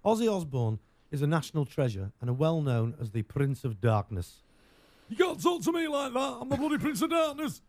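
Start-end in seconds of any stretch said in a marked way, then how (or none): 5.1-7.6 high-pitched, indignant mock rock-star voice. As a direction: put on a comic, high-voiced outburst. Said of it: "That's my [name] impression".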